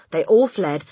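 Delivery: ordinary delivery, nothing marked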